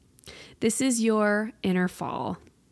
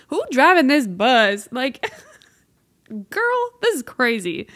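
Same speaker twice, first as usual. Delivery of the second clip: loud, laughing exasperation; exasperated laugh; 4 semitones higher, 7 dB louder than usual. Real, not acted. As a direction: laugh in disbelief at who's in charge.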